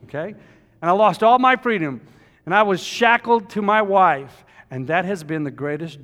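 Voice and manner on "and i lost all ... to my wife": loud preaching, pitched up, every phrase driven out; loud pulpit preaching; full-force preaching. Acted. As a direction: preach it to the back pews.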